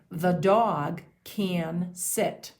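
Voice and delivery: THE DOG CAN SIT, slow and drawn out; word-by-word dictation. Shown as a demonstration. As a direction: dictate each word slowly for young writers. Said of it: "The sentence is".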